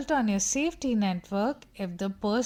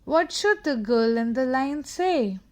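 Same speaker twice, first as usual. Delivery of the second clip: usual speaking voice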